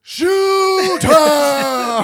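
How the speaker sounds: yelled high and loud; loud shout. Acted. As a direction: bark it like a joke. Joking shout at movie scene.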